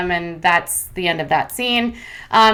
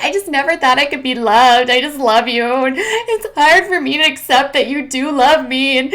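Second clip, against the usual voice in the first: hammed-up weepy plea, pitched higher; mock sobbing lament; mock crying; 5 semitones higher, 3 dB louder than usual. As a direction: parody a tearful confession. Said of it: "sitting on her couch crying".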